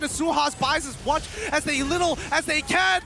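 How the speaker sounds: esports caster. Acted. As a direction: ride the hype of the fight. Hype esports play-by-play.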